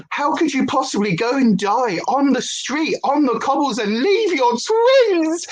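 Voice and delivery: theatrical grief, rising to a slow high wail; mock anguished cry. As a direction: ham up heartbreak at the soap death. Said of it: "I cried".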